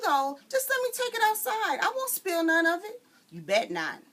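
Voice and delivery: loud, high quarrel; mother-daughter quarrel. Acted. Mama and daughter argue over taking water out. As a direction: go back and forth, pleading and scolding.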